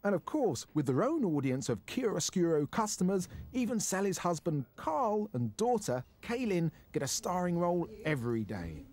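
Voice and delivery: crisp, projected magazine-show narration; TV voice-over. Acted. Presenter introduces a London restaurant to viewers, TV voice-over. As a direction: read it as a polished feature intro.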